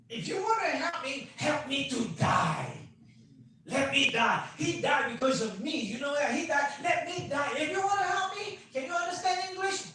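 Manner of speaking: high, loud angry outburst; hysterical shouting. Retold or imitated. Patient yelling at the new doctor, angry shout. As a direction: lash out at the doctor. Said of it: "She said".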